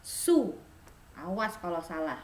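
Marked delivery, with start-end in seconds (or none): 0.0-0.5 loud "su", no s; firm model; correct sous. Shown as a demonstration. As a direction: stress the correct form.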